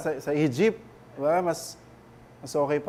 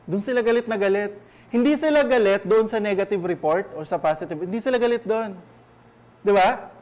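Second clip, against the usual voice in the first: preaching louder and higher about the people's anger; forceful preaching; 3 semitones higher, 4 dB louder than usual. Acted. Pastor rousing the church about grumbling against Moses, forceful preaching. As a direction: drive the point home from the pulpit.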